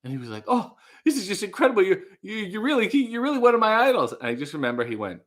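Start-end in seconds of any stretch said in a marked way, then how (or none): 0.5-4.1 high, fast, stammering gush; gushing mock excitement, pitched up; excited fan. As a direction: imitate a starstruck fan tripping over words. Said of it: "the guy was so excited".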